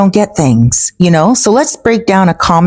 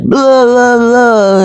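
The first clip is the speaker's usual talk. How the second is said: put-on higher-pitched drunken babble; mock belligerent drunk; 6 semitones higher, much slower than usual. Drunk man fails to explain himself to police, mock belligerent babble. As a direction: imitate a drunk who can't get his words out. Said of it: "and he was belligerent".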